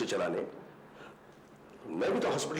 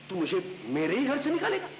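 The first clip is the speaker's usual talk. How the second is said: high, indignant angry question; outraged disbelief; 12 semitones higher than usual. Acted. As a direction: challenge him in outrage. Outraged at being told to leave. (आक्रोश भरी ऊँची आवाज़ में सवाल)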